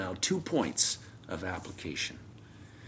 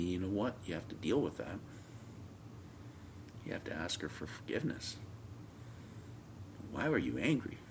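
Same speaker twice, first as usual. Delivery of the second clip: softly voiced word from the Lord; gentle correction; 2 semitones lower, 9 dB quieter than usual. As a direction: imitate a quiet, patient prompting. The Lord quietly corrects him.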